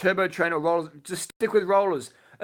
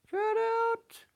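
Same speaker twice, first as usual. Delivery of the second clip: squeaky high voice; 4 dB quieter, much slower than usual. Pretend. Streamer repeats his cycling advice in a joking squeaky falsetto. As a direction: jump way up into a silly falsetto on "Try it out".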